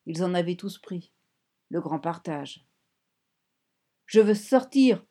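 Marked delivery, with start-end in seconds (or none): 4.1-5.1 loud panicked cry to get out; yelling. A narrator's reading. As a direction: shout it, pushing hard on each word. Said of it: "hurla-t-elle". (cri fort et paniqué)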